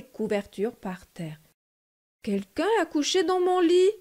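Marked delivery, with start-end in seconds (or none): 2.2-3.9 higher, a bit louder mama bear; lightly voiced indignant mama bear. A narrator's reading. Mama bear sees her pillows on the floor, indignant. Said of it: "de sa moyenne voix".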